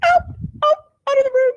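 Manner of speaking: put-on high, loud yell to get the girls out; shooing yell. Mother in pain ordering daughters out, high loud yell. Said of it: "I'm like".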